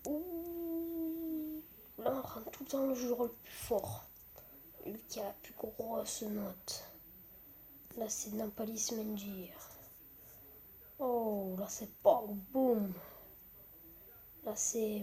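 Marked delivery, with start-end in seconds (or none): 4.9-9.5 chuchoté. Real, not acted. Whispering at night.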